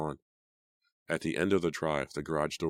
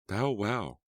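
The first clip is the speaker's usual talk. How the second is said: dry; 6 semitones higher than usual. A narrator's reading. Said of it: "Kimmy said dryly".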